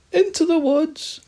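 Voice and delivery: very high, loud, stretched cry of fright; fearful cry. Acted. Guinea pig fleeing the owl, crying out fearfully. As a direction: shriek it in fear. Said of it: "cries the guinea pig fearfully".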